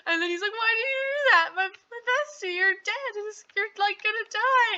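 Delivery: high-pitched, hammed-up panic over a friend; mock freaking out. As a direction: wail in comic distress. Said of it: "he's, like, freaking out".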